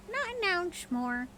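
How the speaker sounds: very high, slow mynah bird voice. A narrator's reading. Mynah coaxing its owner, high bird voice. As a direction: chirp it out like a talking bird. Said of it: "continued the bird".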